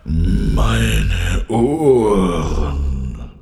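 loud, very drawn-out roaring wail; lion's roar. A narrator's reading. Lion pained by Carla's cello noise, long loud roar. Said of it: "Da brüllte es noch lauter".

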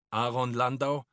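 loud official address; SA man. A narrator's reading. SA man confronting Josef's father, loud and official. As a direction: call out the name like an officer.

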